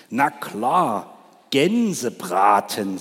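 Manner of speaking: slow, relish on "Gänsebraten"; a sly fox savouring the roast. Acted. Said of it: "sagte der Fuchs". (Der Fuchs schwärmt vom Gänsebraten, langsam genießend)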